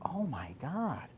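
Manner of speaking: slow, dragged-out dismay; stunned and mortified at herself; slow stunned realization. Retold or imitated. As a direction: let the shock sink in slowly. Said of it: "I was like".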